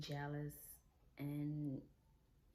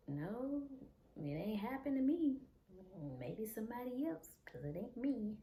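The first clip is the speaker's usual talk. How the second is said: high-pitched protesting denial; acted denial; 5 semitones higher than usual. Covering for him to people asking, high-pitched retold denial. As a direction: deny it in a higher voice, playing innocent.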